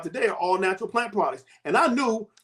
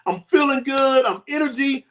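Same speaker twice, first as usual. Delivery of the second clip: excited shout; 7 semitones higher than usual. Real, not acted. Bragging about new energy, shouting.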